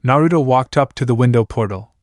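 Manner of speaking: ordinary delivery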